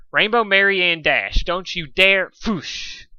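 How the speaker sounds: a furious threat swallowed by a rain splash; full-name threat. Acted. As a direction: scold her by full name, slowly.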